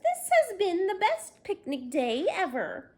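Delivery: high, loud and thrilled; excited announcement. Acted. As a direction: whip up the crowd with joy. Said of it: "she announced".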